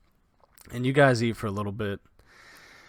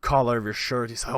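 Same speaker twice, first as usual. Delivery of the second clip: usual speaking voice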